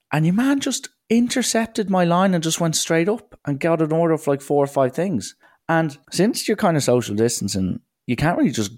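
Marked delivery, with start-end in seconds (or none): none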